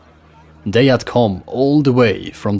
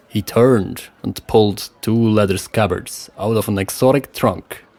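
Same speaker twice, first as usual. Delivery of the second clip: plain reading voice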